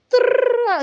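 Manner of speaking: high creaking trill for a squeaky door. Retold or imitated. Telling a ghost story, she mimics the door creaking open. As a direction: imitate a rusty hinge with a rolled trill. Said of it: "es como suena así ese rechinar".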